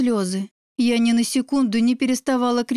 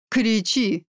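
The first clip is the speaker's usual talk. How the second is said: ordinary delivery, nothing marked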